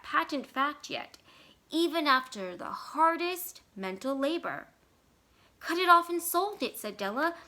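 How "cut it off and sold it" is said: quick high confession; high, louder wife. A narrator's reading. Della admitting the sale to Jim, high and loud.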